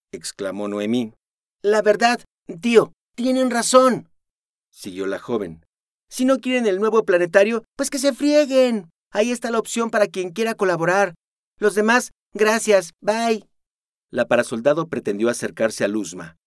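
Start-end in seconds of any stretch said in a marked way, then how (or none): 1.6-13.5 high, loud, sassy brush-off; flippant dismissal. Acted. Luzma dismissing the squad, flippant. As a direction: wave them away with contempt.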